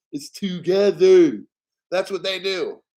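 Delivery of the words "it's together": together slow and loud. Shown as a demonstration. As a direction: hammer together home. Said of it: "Let me say it slow".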